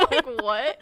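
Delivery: loud, laughing disbelief; giggling through the question. Real, not acted. Friend still cracking up about the yip yip dog.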